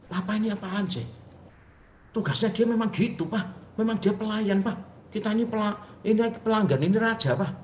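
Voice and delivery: high-pitched mock objection from an entitled kid; indignant protest. Son's indignant protest, acted out.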